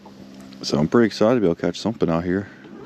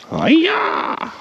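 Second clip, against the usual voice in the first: much higher, playful karate cry; mock karate yell. Hooking a fish, a much higher playful karate yell. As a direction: whoop it like a cartoon kick.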